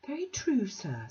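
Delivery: put-on high, genteel old lady; prim Mrs Sparsit. Mrs Sparsit primly questioning Bounderby, high and genteel. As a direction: lift it into a prissy, mannered voice.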